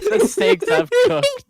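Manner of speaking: high, slow, laughing words. Real, not acted. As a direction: squeal it through laughter. Laughing at misheard steaks.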